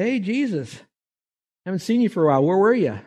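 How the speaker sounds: cheery angel. Acted. Angel greets Jesus in story.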